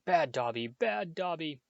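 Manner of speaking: shouting. A narrator's reading.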